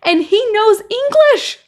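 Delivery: high-pitched, incredulous outburst; exasperated. Real, not acted. Commentator appalled by whispering before dad, exasperated. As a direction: pitch it way up in disbelief.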